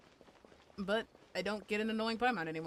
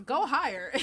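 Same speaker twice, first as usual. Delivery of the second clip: higher, louder mock directing voice; imitated director; 3 semitones higher, 7 dB louder than usual. Director to voice actress, mock pushy call. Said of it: "kept just being like".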